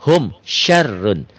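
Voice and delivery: slow, melodic tajwid chanting; chanted Quranic reading; Quran recitation. Shown as a demonstration. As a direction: recite it slowly with drawn-out vowels. Recites example slowly.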